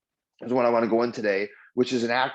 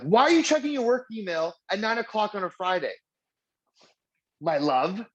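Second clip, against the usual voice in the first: incredulous question; 8 semitones higher than usual. Retold or imitated. Teasing wife about late email.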